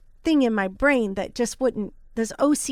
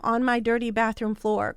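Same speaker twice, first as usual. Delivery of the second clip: ordinary talk, nothing marked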